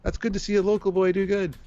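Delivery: high, hearty retold praise; imitated fan. Host retells a local fan congratulating him, imitated fan voice. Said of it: "He goes".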